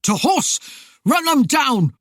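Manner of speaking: furious commander's shouted orders; loud, high, urgent command. A narrator's reading. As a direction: shout the charge, pitch leaping up. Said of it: "Alan shouted".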